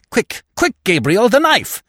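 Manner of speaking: frantic urging. Acted. Widow urging nephew.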